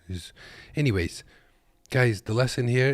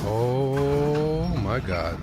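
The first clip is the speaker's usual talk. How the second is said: stunned oh my God; 6 semitones higher, 6 dB louder, much slower than usual. Real, not acted. Reacting to embarrassing crash video.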